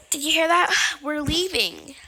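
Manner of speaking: loud excited whisper. Acted. Player telling viewers the plane is leaving, excited whisper. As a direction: hiss it out, thrilled.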